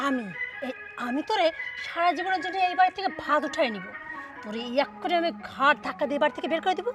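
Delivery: daughter-in-law quietly threatening to throw her out; quiet menace. Acted.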